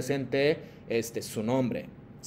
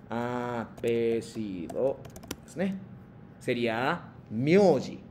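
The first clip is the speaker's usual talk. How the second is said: slow teaching; 3 semitones lower, 4 dB quieter, much slower than usual. Shown as a demonstration. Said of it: "Vamos a practicar las pronunciaciones".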